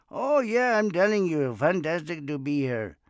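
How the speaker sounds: put-on loud, high Indian accent, easing toward the end; hammed-up Indian accent impression; heavy Indian accent. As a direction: imitate an enthusiastic Indian man. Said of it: "talked like that heavy accent".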